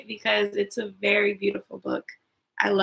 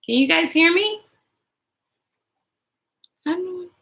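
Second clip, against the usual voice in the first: ordinary delivery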